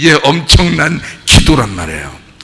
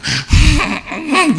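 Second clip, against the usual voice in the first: high whimpering fake cry; mock sobbing; 10 semitones higher than usual. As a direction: snivel like a tearful supplicant. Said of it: "눈물 찔찔끔찔끔 흘리면서".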